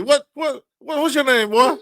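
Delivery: high, loud booming uncle bellow; hammy tipsy uncle hollering; barbecue uncle voice. Retold or imitated. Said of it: "I sound like somebody uncle at the barbecue".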